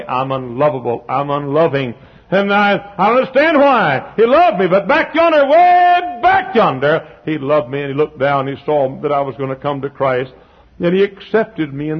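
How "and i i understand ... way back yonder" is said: high, loud preacher climb; preaching at full force. Real, not acted. Preacher lifting the congregation, rising and ringing. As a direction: build the sermon to a ringing peak.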